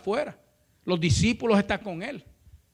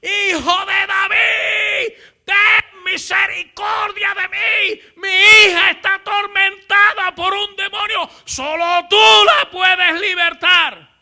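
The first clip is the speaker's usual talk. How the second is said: relentless high shout, words stretched slow; loud, high begging from far behind; crying out repeatedly; 11 semitones higher, 8 dB louder, slower than usual. Retold or imitated. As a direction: yell the plea insistently, dragging it out. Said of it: "no había terminado bien la frase cuando lo oía".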